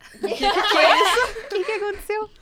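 high, loud, drawn-out disbelief through laughter; hammed-up incredulous outburst; mock astonishment. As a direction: act stunned, laughing as you ask. Acted startled reaction to confession.

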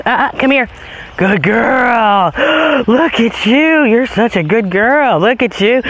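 high, bright-eyed praise for the dog; excited dog praise. Real, not acted. Owner praising dog for not chasing, gushing high voice. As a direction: gush over her like a proud owner.

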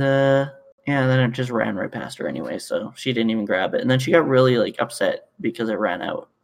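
ordinary talk

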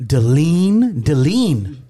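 "Deline" said Duh-LEEN, stress on the end; mispronounced name. Retold or imitated. Dad imitates strangers misreading the name "Deline". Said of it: "'cause of the accent".

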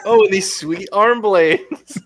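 laughing impression of a boastful hero; laughing mock boast. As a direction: brag about the blades through a laugh. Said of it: "and he's like".

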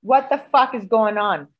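slow, indignant demand; retold angry demand, each word leaned on. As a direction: demand an answer, fed up. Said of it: "madder than a hornet".

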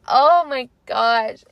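normal talking voice

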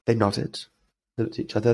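ordinary talk

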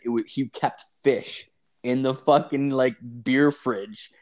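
ordinary talk

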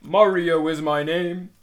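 loud, slow mock Mario catchphrase; Chris Pratt. Podcast host mocking a movie casting, loud impression. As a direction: belt it out like a hammy hero. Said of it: "when Chris Pratt's in it, and he's like".

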